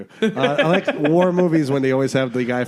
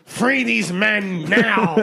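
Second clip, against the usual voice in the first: slow, higher, drawn-out battle cry; mock rallying cry; 4 semitones higher, much slower than usual. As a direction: stretch every word to rally the troops. Demonstrates firing up the troops.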